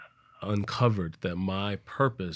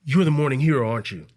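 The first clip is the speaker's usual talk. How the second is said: loud, raised-pitch burst of recognition; urgent accosting; 6 semitones higher, 10 dB louder than usual. Retold or imitated. Stranger grabbing a runner's arm, urgent recognition. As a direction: imitate an eager stranger calling someone out.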